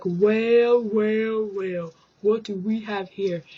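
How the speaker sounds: drawn-out smug well well well, then back to plain; smug villain line. Retold or imitated. Man walking in on a scene, smug mock villain. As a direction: play it like a movie baddie catching someone. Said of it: "He's like".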